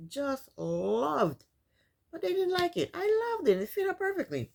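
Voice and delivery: gushing. Real, not acted. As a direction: rave about the dress she loved. Gushing about the dress.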